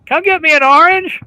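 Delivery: loud, high holler down the stairs; yelled demand. Acted. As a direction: shout an order to another floor. Wife repeats yelled order.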